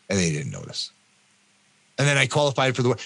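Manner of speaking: usual speaking voice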